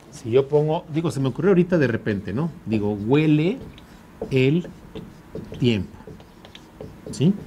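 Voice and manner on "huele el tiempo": "huele", "el", "tiempo" with pauses between them; syllables separated. Shown as a demonstration. Teacher breaking the phrase apart for the class, halting. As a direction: pause between each word. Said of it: "Si yo digo lisa y llanamente en sílabas".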